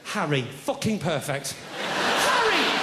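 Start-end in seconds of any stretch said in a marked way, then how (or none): 1.8-2.8 high, incredulous cry of the name; mock-outraged exclamation; exasperated. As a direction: yelp it in high-pitched disbelief. Exasperated at dull pet name.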